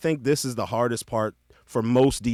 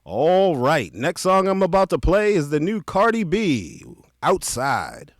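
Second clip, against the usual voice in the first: drawn-out, matter-of-fact song intro; unexciting DJ voice, just announcing; dull DJ announcement; 5 semitones higher, 4 dB louder than usual. Shown as a demonstration. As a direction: announce the next song without any hype, falling away at the end. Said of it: "Kinda dull".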